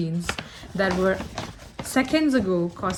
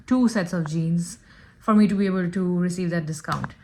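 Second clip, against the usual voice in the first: normal talking voice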